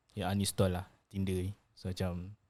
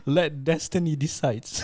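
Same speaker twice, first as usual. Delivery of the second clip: laughing; 5 semitones higher, 7 dB louder, slower than usual. Acted. Dramatic mock-solemn line, laughing.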